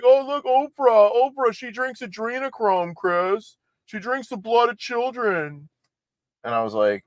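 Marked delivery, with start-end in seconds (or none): 0.0-5.7 high, sneering mock voice; mocking conspiracy theorists. Host mocking QAnon fans in a high, sneering voice. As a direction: parody the believers as gullible. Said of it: "they say".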